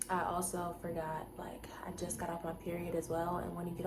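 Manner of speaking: confiding unvoiced whisper. Real, not acted. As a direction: whisper it like a secret. Confiding aside about period.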